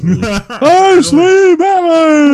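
high, loud goofy dopey shout; dumb guy voice. Pretend. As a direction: play Batman as a total doofus. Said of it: "a dumb guy voice".

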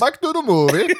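mock Eastern European accent. Mimics cohost's joke accent.

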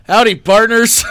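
loud, hearty howdy; cowboy greeting. Retold or imitated. Host suggests greeting a bar, hearty cowboy howdy. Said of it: "when you walk into a bar".

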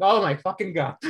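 breathless, gasping excitement; panting with giddy shock; hyperventilating. Real, not acted. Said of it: "I'm hyperventilating a little".

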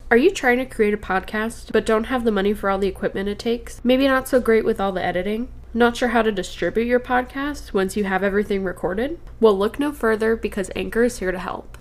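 loud, projected sponsor read. Acted. Host pitching a podcast tool to listeners, ad read.

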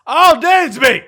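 high, loud fan yell at the game; excited yell. Real, not acted. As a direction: yell out at the player in real excitement. Live reaction to a play.

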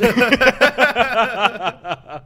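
loud high laugh bursting out; big burst of laughter. Real, not acted. Laughing hard at his own pasta joke, loud burst. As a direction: crack up loudly at the joke.

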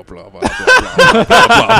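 high, squeaky laughing gibberish; dissolving into giggles mid-sentence; laughing blah blah. Real, not acted. Trailing off into laughter.

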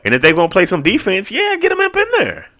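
building up, then a high squeal on the last words; rising hype until the voice jumps way up; excited. Real, not acted. Podcaster hyping fans to play the rookies, rising into a high excited squeal.

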